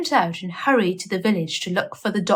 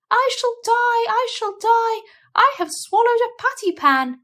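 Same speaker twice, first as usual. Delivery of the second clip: high wailing howl. Acted. Duchess howling that she swallowed a patty pan, high wail. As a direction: wail it out in panic, pitched high. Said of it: "Duchess set up a howl".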